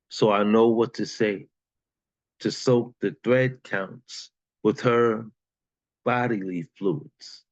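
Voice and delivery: rhythmic poem performance; spoken word poetry. Acted. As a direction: perform it like a slam poet. Recites a poem.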